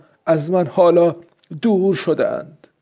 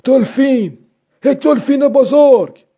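high, raised-voice cry; loud exclamation; 8 semitones higher, 5 dB louder than usual. A narrator's reading. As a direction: call out the sighting aloud, pitched well up. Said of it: "پیرمرد با صدای بلند گفت". (با صدای بلند)

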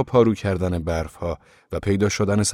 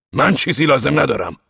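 loud, raised-pitch rebuff; angry refusal; 7 semitones higher, 6 dB louder than usual. A narrator's reading. Ove pushes the reporter away at the door, loud angry refusal. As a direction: refuse loudly and firmly, to get rid of someone.

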